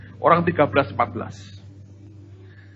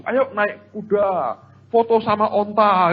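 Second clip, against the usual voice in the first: imitated vendor, drawn out and higher; slow, raised sing-out of an offer; hawker's call; 5 semitones higher, slower than usual.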